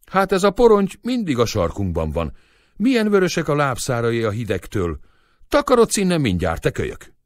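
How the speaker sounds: high, loud telling-off; cross scolding. A narrator's reading. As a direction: shoo the child away crossly. Istók chases the boy away.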